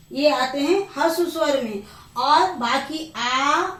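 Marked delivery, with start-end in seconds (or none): 3.2-3.7 आ held long and slow; teacher sounding a long आ; long vowel drawn out. Shown as a demonstration. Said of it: "दीर्घ ई".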